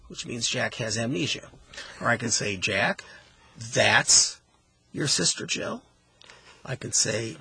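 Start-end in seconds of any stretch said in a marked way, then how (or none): 2.6-5.8 "that's" punched and set apart by pauses; stress on THAT'S. Shown as a demonstration. Host showing how emphasis changes meaning, stress on "that's". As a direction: hit "that's", then rise through "your sister, Jill". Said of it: "by the way that we emphasize".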